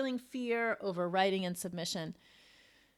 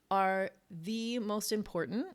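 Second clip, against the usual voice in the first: ordinary delivery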